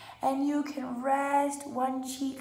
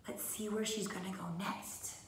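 whisper; 3 semitones lower, 7 dB quieter than usual. Acted. Teacher whispers to kids.

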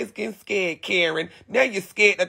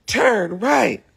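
higher mock GPS command; the other GPS voice; 4 semitones higher than usual. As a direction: mimic the usual GPS lady, pitched up. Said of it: "the other one, she be like".

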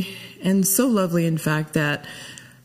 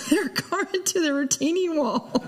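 real laughter breaking through the words; half-laughing, softer, going higher; 9 semitones higher, 5 dB quieter than usual. Real, not acted. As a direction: let the giggle take over the end of the story. Laughing at the funny memory.

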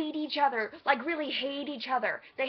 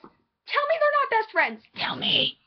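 high, dramatic fangirl challenge; high-pitched, mock-desperate insistence; impassioned demand; 11 semitones higher than usual. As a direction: demand it of the doubters.

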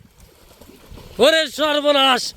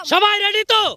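yelling across the room; 6 semitones higher than usual. Real, not acted. Calling to racers before start.

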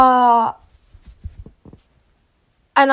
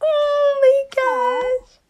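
much higher, breathless delight; delighted squeal; 13 semitones higher than usual. Real, not acted. Admiring the dressed-up character, a high cooing delight. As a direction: coo in delight.

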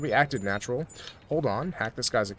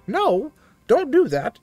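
higher, slow protesting sister; mock sister voice; 11 semitones higher, 6 dB louder, slower than usual. Streamer voices the sister telling Aiden no, high and slow. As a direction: squeal it as the startled sister.

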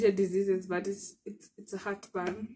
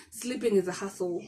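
ordinary talk

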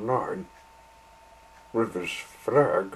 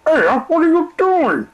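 guard's shout; 13 dB louder than usual. Acted. Guard shouts after train.

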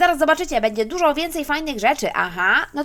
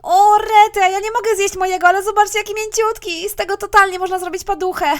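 gushing; 3 semitones higher, 7 dB louder than usual. Acted. Mother gushes over squishy.

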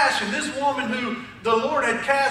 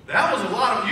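ordinary delivery

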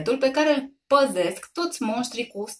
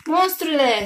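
higher, louder cry at the monster; shouted threat; 7 semitones higher, 5 dB louder, slower than usual. A narrator's reading. Mother shouts at the monster, higher and louder. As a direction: yell to scare it off. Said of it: "strigă ea".

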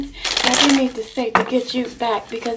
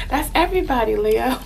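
usual speaking voice

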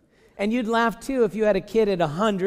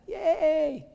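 a high mock yay; mock cheer; 11 semitones higher than usual. Preacher mocks commentators' excited Abraham, high mock cheer. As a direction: squeal it much higher, tongue in cheek.

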